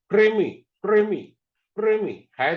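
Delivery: क्रीमी said firmly with kr cluster; say kreemi. Shown as a demonstration. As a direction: drill of क्रीमी. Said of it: "आपको अच्छे से समझ में आएगा कि".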